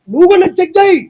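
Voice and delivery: high, loud retort; sharp shout. Real, not acted. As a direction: fire it back hard. Retort to interviewer's drinking jibe.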